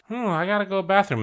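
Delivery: put-on bored kid excuse. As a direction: play the kid slipping away with a fake excuse. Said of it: "they'll just kinda like".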